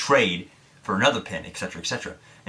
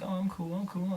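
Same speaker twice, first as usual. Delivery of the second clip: high, soft nervous voice; high squeaky faked cool; nervous squeak; 10 semitones higher, 9 dB quieter than usual. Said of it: "I'm like".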